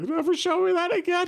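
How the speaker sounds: put-on high-pitched scared kid protesting; upset child. Nine-year-old to dad after the scare, upset. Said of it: "And, like, I was like".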